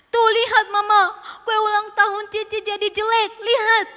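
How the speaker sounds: high, loud, indignant complaint; aggrieved child. Acted. Sister showing Mama the spoiled cake, loudly aggrieved. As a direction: demand that your mother look.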